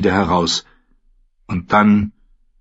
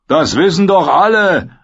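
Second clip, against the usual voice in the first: taunting reply; 10 semitones higher, 4 dB louder than usual. A narrator's reading. Karl answers the furious host, taunting. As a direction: goad him with a raised voice.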